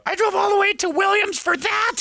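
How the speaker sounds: high mock screaming. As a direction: shriek it from the car window. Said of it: "he was heard screaming out of his car".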